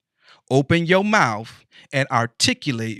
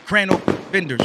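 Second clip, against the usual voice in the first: excited hype; 6 semitones higher, 3 dB louder, faster than usual. Real, not acted. Hyping friend's grand opening.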